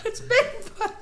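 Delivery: high, soft mock cry of alarm; panicked witness. Spotting Bigfoot for a laugh, mock panic. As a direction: squeal it like a startled onlooker.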